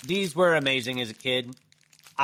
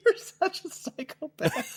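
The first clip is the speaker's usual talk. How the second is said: laughing; slower than usual. Real, not acted. Laughing mock insult at cohost.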